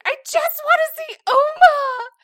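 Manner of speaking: high, loud imitation of a wailing little girl; mock bawling child. Mom imitating daughter's tearful outburst, high and loud. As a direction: wail it like the upset daughter. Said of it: "she just went ballistic".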